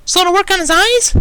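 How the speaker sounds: very high, loud anxious question; frantic. A narrator's reading. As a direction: press for an answer, panicky. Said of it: "a little more frantically this time".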